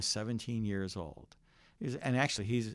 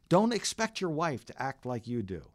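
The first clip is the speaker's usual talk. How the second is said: ordinary delivery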